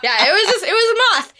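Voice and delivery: usual speaking voice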